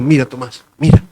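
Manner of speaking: Jesus gently inviting Thomas; merciful, gentle. Retold or imitated. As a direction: coax him gently to touch. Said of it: "tuvo misericordia de él y dijo".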